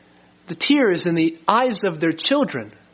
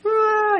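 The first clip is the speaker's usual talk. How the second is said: put-on child's wail. Child in lead crying.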